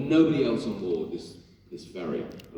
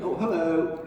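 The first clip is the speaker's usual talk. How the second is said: higher, easy "hello"; his Gale hello; 8 semitones higher, slower than usual. Shown as a demonstration. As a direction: greet as Gale would. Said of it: "that's how I say hello".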